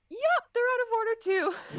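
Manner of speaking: very high, put-on agreeable voice; mock-helpful. Crew member humouring passenger about elevators, mock-helpful. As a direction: humour them in a high, fake-polite voice.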